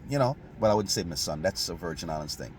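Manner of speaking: ordinary talk, nothing marked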